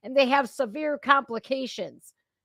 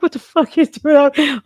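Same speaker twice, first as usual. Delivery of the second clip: higher, louder mock outburst; retold outburst; 5 semitones higher, 9 dB louder than usual. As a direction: blurt it out in disbelief. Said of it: "I'm like".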